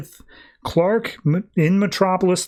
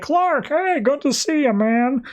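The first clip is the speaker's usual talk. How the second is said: high, bright townsfolk hello, much higher; friendly greeting; 10 semitones higher, slower than usual. Retold or imitated. Townsfolk waving at returning Clark, high cheery hello. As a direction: call out a cheery hello to an old neighbor.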